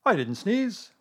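high, loud protest; indignant denial. A narrator's reading. Owl denying a sneeze to Pooh, indignant.

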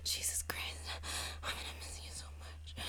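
intimate whisper; hushed lover's whisper. Acted. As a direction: whisper it close and spent.

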